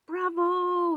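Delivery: high, loud cheering bravo; yell. Acted. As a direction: cheer the magician loudly. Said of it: "yelled Mole".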